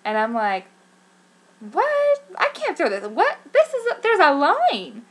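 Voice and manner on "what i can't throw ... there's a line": high, loud shocked disbelief; reenacted shock, pitch leaping up; freaking out. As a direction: blurt it out in stunned, high-pitched panic. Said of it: "I was freaking out".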